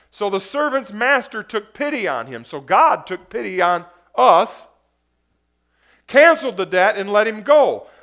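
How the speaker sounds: loud, driving sermon voice; preaching at full force. Acted. Pastor retells the parable to the congregation, preaching loudly. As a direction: preach it out to the back row.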